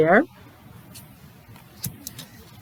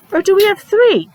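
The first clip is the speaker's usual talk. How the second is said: playful quiz; 11 semitones higher, 3 dB louder than usual. Acted. Teacher quizzing kids playfully.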